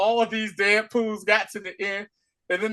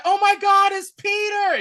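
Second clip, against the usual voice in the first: much higher, louder cheer; excited shout; 5 dB louder than usual. Retold or imitated. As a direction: scream with joy at Peter. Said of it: "they all was like".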